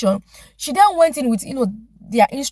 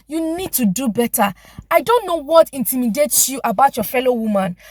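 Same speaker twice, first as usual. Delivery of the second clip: usual speaking voice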